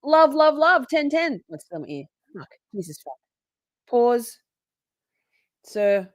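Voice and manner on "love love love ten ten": much higher, louder love love love; excited cheering. Real, not acted. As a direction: cheer it out like a fan.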